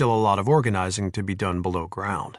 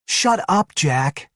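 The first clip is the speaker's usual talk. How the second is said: annoyed; 8 semitones higher, 4 dB louder, much slower than usual. A narrator's reading. Annoyed woman snaps back.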